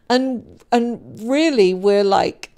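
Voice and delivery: ordinary talk, nothing marked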